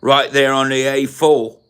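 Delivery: put-on English accent, needling; exaggerated British accent with swooping pitch; mock British accent. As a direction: scold someone in a hammed-up English accent. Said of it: "We talk like this".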